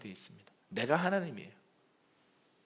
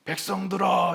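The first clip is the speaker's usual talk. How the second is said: mock proclamation, a bit higher; spokesman calling out; 5 semitones higher, 4 dB louder than usual. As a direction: call out to the crowd. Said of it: "이렇게 막 이렇게 외칩니다". (대변자가 백성에게 외치는 소리)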